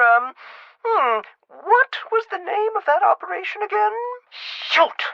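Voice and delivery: very high-pitched, hesitant granny voice; dithery grandmother. Acted. Grandmother phoning to ask after Jasmine, high and forgetful. Caller posing as a patient's grandmother.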